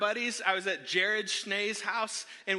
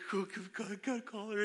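hushed, rapid urging; urgent egging-on; 6 dB quieter than usual. Retold or imitated. Buddies around him urging the call, hushed egging-on. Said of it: "All my buddies around me".

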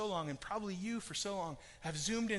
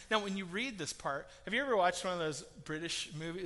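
usual speaking voice

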